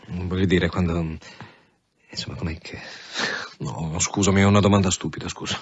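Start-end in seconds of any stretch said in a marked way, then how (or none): 2.1-2.8 trailing off to a whisper; embarrassed whisper. Acted. Commissioner fumbling a personal question to Claudia, embarrassed whisper. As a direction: lose nerve mid-question.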